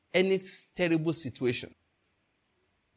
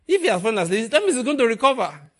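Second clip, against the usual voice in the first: Peter's eager voice, louder and higher; excited Peter; 6 semitones higher, 6 dB louder, faster than usual. Retold or imitated. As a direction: play Peter bursting with relief. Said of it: "Peter says".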